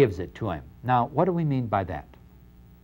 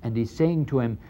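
normal talking voice

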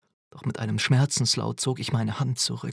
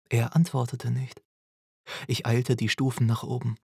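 ordinary delivery, nothing marked

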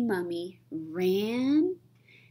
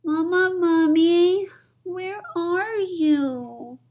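put-on high little-kid plea; squeaky begging little mummy; pleading child; 10 semitones higher, 5 dB louder, slower than usual. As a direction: beg in a much higher child voice. Baby mummy calling out.

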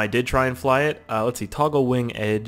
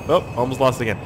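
normal talking voice

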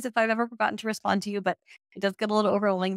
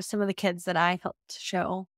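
normal talking voice

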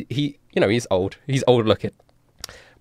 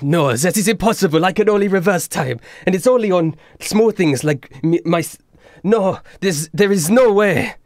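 higher, louder insisting voice; insistent denial; 7 semitones higher, 4 dB louder than usual. Acted. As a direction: refuse to believe it. Louis denies it.

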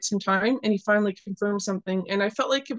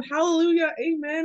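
higher-pitched imitation of joyful praise; mock praising; 6 semitones higher than usual. Garbage man praising the Lord in a cafeteria, lightly imitated exultation. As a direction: imitate a stranger breaking into praise. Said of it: "He starts praising the Lord and going".

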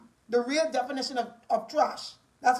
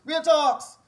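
normal talking voice

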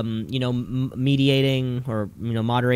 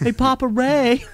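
mock yell, pitched way up; imitated yelling; 10 semitones higher, 4 dB louder than usual. Wrestler Seth Rollins yelling to the camera, high mock yell. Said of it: "of him yelling".

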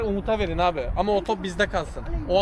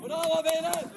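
high, distant cheer; calling out praise; 8 semitones higher, slower than usual. Real, not acted. Praising teammates mid-match, high far-off call. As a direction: cheer the team from afar.

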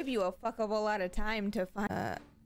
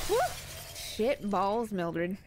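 ordinary delivery

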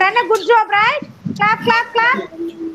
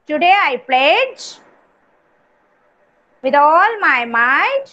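slow, measured recitation with lower pitch; anthem recitation; 4 semitones lower, slower than usual. Shown as a demonstration. Teacher reciting the kids anthem for children to repeat, slow recitation. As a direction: lead line by line, drawing out each phrase.

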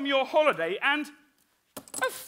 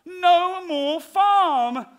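slow dramatic pronouncement; 3 semitones higher, 4 dB louder, much slower than usual. Acted. Marty slowly repeating the warning to the cow. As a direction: drag each word out heavily.

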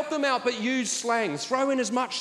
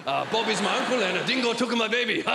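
mock tourist Aussie slang. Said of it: "How was Australia?".

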